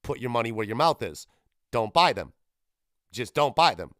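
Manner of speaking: ordinary delivery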